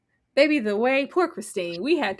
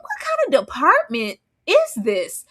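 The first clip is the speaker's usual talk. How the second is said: high, drawn-out disbelief; incredulous; 6 semitones higher, 3 dB louder than usual. Real, not acted. Friend reacts to the police department, incredulous. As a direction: stretch it out in shock.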